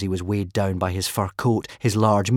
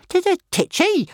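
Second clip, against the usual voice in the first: high, offended stammering dragon; offended dragon huffing at being called titchy, high; huffy; 5 dB louder than usual. A narrator's reading. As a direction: huff at the insult. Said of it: "huffed Toothless".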